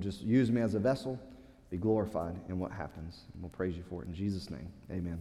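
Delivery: soft, low, hushed prayer voice. Acted. Pastor praying over the congregation before the sermon, hushed and low. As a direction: pray quietly and humbly.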